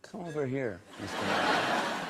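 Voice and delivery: lightly put-on accusing tone; accusatory. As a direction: imitate a teacher calling someone out. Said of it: "looking at me kind of accusatorily".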